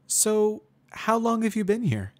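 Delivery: Twilight, strained. A narrator's reading. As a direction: patience wearing thin, voice tightening. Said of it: "Her voice sounds a little more strained this time".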